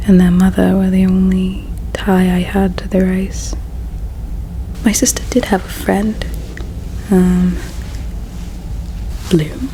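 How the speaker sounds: usual speaking voice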